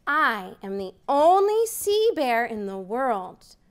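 ordinary talk

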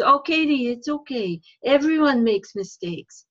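put-on a kindly puppet fox comforting in a light French accent. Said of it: "And Mister Renard says".

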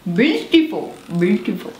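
"Beautiful" in a light Indian accent. Shown as a demonstration. Teacher demonstrating an Indian accent for students. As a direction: imitate an Indian English speaker saying the word. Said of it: "os indianos".

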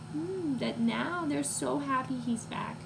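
ordinary delivery, nothing marked

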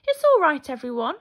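high, loud opening call. A narrator's reading. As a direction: call to everyone in hiding. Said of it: "he called".

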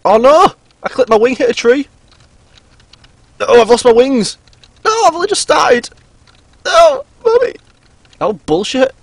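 high, loud panicked outburst; real shock after the crash; panicked yell. Real, not acted. Crashed plane into tree.

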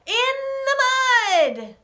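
slow, very high chant; gleeful squeal. Shown as a demonstration. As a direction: squeal it long and high for children. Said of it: "So we're gonna say".

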